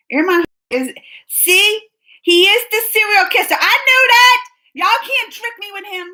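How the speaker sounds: high, loud I-told-you-so; excited gloating, pitch shooting up; triumphant. Real, not acted. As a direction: crow about being proved right. Crowing at being proved right.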